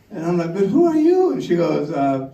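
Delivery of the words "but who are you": high, loud, baffled; puzzled man asking the unknown caller, startled; startled question. Retold or imitated. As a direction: blurt it out, totally thrown. Mystery caller on the phone.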